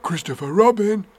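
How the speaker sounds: high, voiced call of a name. A narrator's reading. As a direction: call down to a friend. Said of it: "he called down to you again".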